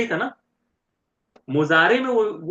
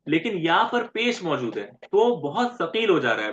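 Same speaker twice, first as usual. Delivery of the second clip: ordinary talk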